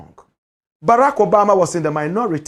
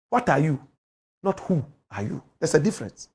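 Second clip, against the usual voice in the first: usual speaking voice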